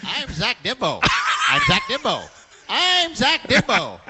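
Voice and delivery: high, deliberate candidate intro with a swooping fall; Zach Dimbo. Acted. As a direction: repeat the name like an overplayed ad tagline. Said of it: "that's kinda how he sounds".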